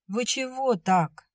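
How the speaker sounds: bewildered and a touch affronted; confused indignation. A narrator's reading. Soldier thrown by Vera's rudeness, confused and indignant. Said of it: "Растерянность смешалась в его голосе с возмущением".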